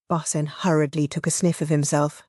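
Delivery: plain reading voice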